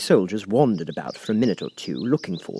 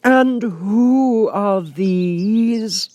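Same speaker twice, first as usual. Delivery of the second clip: high, slow, lofty Queen voice; imperious Queen; 12 semitones higher, 6 dB louder, much slower than usual. A narrator's reading. The Queen questioning Alice, lofty and slow. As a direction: look down on the child from the throne. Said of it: "said the Queen".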